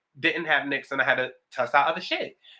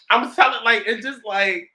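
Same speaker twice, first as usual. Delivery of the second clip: high voice; 6 semitones higher, 6 dB louder than usual. Real, not acted. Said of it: "I'm really up here".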